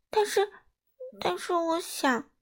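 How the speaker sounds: high, halting little bat voice; hesitant. A narrator's reading. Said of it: "小蝙蝠吞吞吐吐的". (音调偏高，吞吞吐吐)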